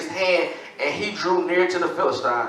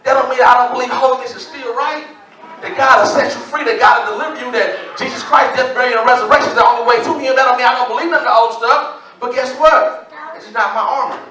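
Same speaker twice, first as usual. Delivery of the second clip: higher, louder preaching cadence; full preaching; 6 semitones higher, 4 dB louder than usual. Acted. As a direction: drive each line home to the church.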